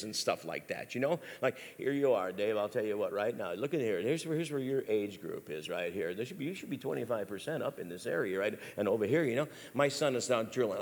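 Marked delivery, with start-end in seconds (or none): 1.8-10.8 low, soft lecturing drone; a hint of a dull data presentation; lightly voiced chart presenter. Father explaining a pie chart to his son, droning lightly. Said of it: "I never use a pie chart and percentages".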